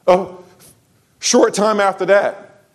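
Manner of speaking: ordinary delivery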